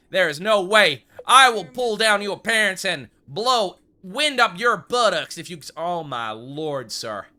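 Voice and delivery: put-on loud blustering threat in a Western accent; hammed-up cowboy tirade; mock outrage. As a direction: holler it like an outraged frontier storekeeper.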